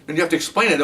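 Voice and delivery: ordinary talk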